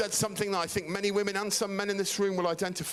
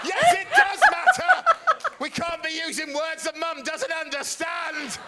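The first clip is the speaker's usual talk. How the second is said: much higher, louder yell through laughter; big brother shouting through giggles; laughing shout; 13 semitones higher, 8 dB louder than usual. Retold or imitated. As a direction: crack up while yelling it. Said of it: "I went back to being an older brother".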